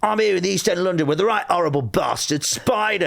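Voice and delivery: high, punchy Cockney hard-man piece to camera; mock East End presenter introducing a thug; Danny Dyer. Imitating an actor doing his link to camera, Cockney hard man. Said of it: "He goes".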